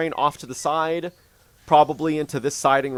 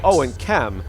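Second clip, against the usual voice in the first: ordinary talk, nothing marked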